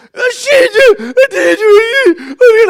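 put-on high, loud sobbing little girl; weeping child. Father imitating his daughter sobbing to him. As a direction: sob it out high like a heartbroken eight-year-old. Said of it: "Hadley is just weeping".

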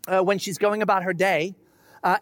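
ordinary talk, nothing marked

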